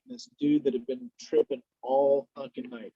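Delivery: ordinary talk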